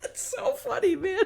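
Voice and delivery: high, wheezing laugh through the words; crying laughing. Real, not acted. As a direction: gasp it out between laughs. Laughing fit.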